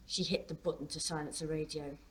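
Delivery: ordinary delivery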